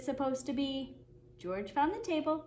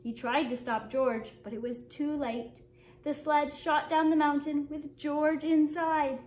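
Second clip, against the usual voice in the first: usual speaking voice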